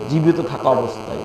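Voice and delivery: said softly, low and even; understated first mention. Real, not acted. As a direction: drop the voice low and soft on the phrase. First take before emphatic repeat. (নিচু, নরম গলায়)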